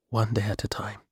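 soft, hushed near-whisper; gently hushed, intimate line. A narrator's reading. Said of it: "she whispered".

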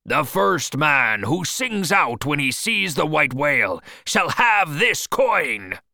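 high, loud proclamation; crying out. Acted. Captain promising the crew a reward, crying out. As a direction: announce the reward to every man, ending slowly. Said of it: "he cried".

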